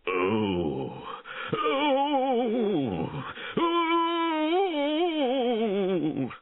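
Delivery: long high dying howl; theatrical wailing "oh"; crying out. A narrator's reading. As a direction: draw out howls of feigned agony. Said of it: "He cried".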